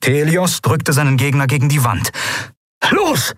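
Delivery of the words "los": high-pitched urgent call; sharply raised pitch, urging him on. A narrator's reading. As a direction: call an order to a comrade mid-fight. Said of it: "rief er Kai zu".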